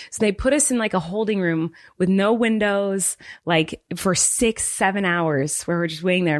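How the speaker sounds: normal talking voice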